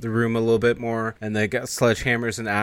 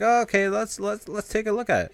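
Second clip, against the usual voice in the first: flustered reaction; 12 semitones higher than usual. Retold or imitated. Character reacting to sick friend.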